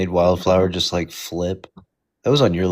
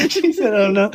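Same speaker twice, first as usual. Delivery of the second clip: much higher and laughing through it; 3 dB louder than usual. Real, not acted. Friend cracking up while quoting the host's reaction. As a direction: squeal the words out mid-laugh.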